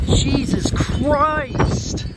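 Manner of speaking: high, drawn-out awed cries; awestruck shouting. Real, not acted. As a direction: cry out at the storm. Storm surge, big wave.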